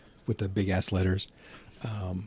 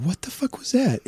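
retold disbelief, pitch leaping way up; mock shock at what just happened; high squeak of retold amazement; stunned what the fuck. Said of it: "And I was like".

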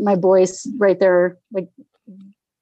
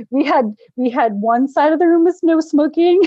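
amused telling; 6 semitones higher than usual. Real, not acted. Laughing at absurd smoking arrangement.

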